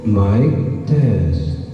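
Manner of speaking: mic test with heavy echo; digital echo. Real, not acted. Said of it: "May echo, mahal".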